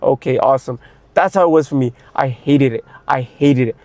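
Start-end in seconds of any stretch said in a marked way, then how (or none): 0.0-0.8 sarcastic resignation. Retold or imitated. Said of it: "I'm like".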